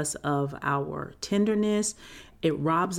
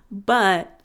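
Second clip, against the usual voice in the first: usual speaking voice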